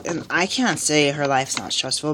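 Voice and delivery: ordinary talk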